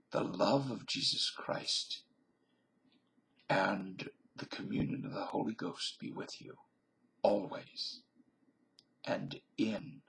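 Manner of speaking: slow, hushed blessing with long pauses; benediction. Acted. Vlogger blessing his viewers at the end, slow and hushed. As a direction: bless the viewers like a pastor closing a service.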